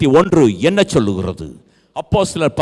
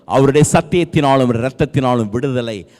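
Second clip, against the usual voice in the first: ordinary talk, nothing marked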